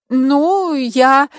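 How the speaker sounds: high, hesitant answer with false starts; tentative, girlish-high self-promotion; slightly unsure. A narrator's reading. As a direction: grope for words, pitched well up. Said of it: "чуть неуверенно улыбнулась".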